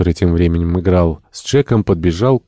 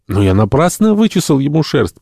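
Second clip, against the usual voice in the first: high-pitched, disappointed complaint; openly chagrined; 8 semitones higher, 4 dB louder than usual. A narrator's reading. Entomologist found nothing on the dog, openly chagrined. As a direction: lament wasted effort. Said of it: "продолжал с нескрываемым огорчением энтомолог".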